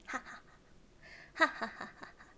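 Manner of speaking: breathy giggles. Real, not acted. Giggling at her own joke.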